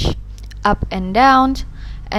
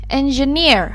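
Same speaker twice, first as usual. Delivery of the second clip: engineer with the last syllable, -neer, stretched and stressed; 4 semitones higher than usual. Shown as a demonstration. Teacher showing learners syllable stress in "engineer", stressed on -neer. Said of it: "louder, longer, and higher in pitch".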